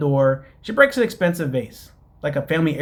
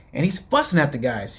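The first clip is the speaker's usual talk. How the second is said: ordinary talk, nothing marked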